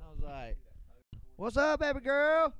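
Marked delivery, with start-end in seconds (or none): 1.4-2.5 high, stretched-out holler; retold shout, pitched way up; yelling back. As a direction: call back flirtily in a high voice. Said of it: "I just yell back".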